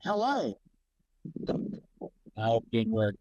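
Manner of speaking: hollow, muffled, like in a tunnel; muffled mic. Real, not acted. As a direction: voice through a blocked mic. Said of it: "It sounds like you're in a tunnel".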